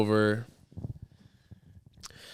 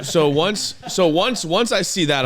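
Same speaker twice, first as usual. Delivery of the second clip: excited; 8 semitones higher, 4 dB louder than usual. Real, not acted. Building to the punchline.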